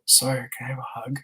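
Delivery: unvoiced whisper close to a small boy. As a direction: coax him in a soft whisper. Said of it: "I get down on my hands and knees".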